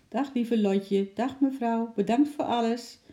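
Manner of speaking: high little animal voice; squirrel's farewell. A narrator's reading. Squirrel saying goodbye to the girl, high-voiced. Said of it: "Alsof hij wilde zeggen".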